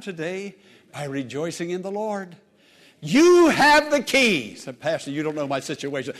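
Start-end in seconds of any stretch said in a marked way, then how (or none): 3.0-4.5 loud, high and slow; stretched, forceful pulpit cry; emphatic exhortation. Real, not acted. Preacher climax to congregation. 4.8-6.1 quick, lower aside as a doubter; lightly voiced objector; objecting member.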